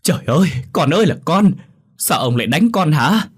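acted distraught mother; shocked reproach. A narrator's reading. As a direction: cry out stunned and accusing. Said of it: "Tiếng bà Nga bàng hoàng thốt lên trách móc".

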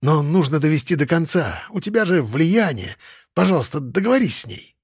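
ordinary delivery

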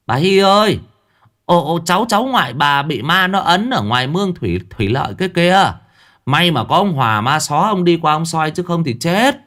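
high, loud panicked calling; frantic yell. A narrator's reading. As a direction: shout breathless news to the grandmother. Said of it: "hớt hải gào lên". (gào lên hớt hải, cao và to)

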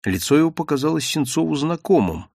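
plain reading voice

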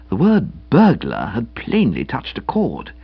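ordinary delivery, nothing marked

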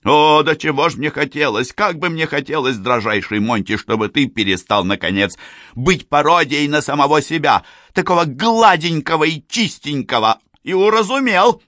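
rising, loud irritated tirade; angry. A narrator's reading. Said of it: "добавил сердито".

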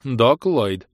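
even, impassive machine reply; dispassionate machine. Acted. The bureaucrat AI replies to Gwyneth, flat and impassive. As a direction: answer without any feeling. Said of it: "бесстрастно отозвался бюрократ".

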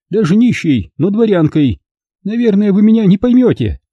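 put-on high, proud elderly noblewoman; old lady voice. A narrator's reading. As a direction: speak as a prim dignified old lady.

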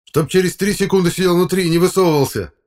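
high, loud angry command; yelled threat. A narrator's reading. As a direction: lay down the law at full volume. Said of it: "заорал я".

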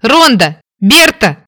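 loud desperate call for help; calling out loudly. Acted. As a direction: shout the names for help. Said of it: "Громко закричала".

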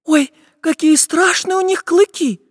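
put-on very high, scared little-girl voice; frightened girl. A narrator's reading. Nastenka sees the walrus tusks, high and frightened. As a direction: gasp out the words in high-pitched fright. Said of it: "испугалась Настенька".

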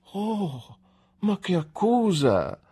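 high, wide-eyed gasp of offence; a young wife stung by an accusation; shocked exclamation. A narrator's reading. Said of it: "esclamò Dora sbarrando gli occhi". (esclamazione scandalizzata, voce alta e stupita)

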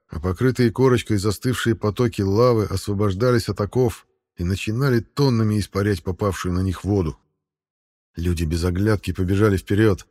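ordinary delivery, nothing marked

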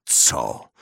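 ordinary delivery

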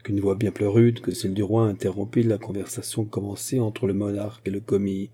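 ordinary delivery, nothing marked

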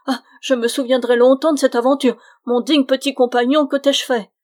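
loud, high, pleading outcry; distressed shouting. A narrator's reading. Friar ridden by the goblin, pleading in a shout. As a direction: cry out in protest at the tormentor. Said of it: "criait le malheureux Bruno".

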